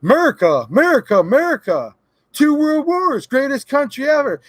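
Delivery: hammed-up "Merica" patriot voice; mock patriotic gun owner. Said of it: "these gun owners in this country that say".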